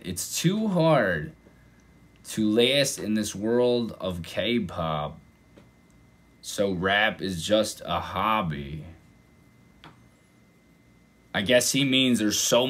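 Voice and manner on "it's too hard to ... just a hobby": slow, pondering re-read; thinking it over. Real, not acted. Reactor rereads the lyric to understand it, slow and pensive. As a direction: read it slowly in pieces, weighing each phrase. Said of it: "let me think about that".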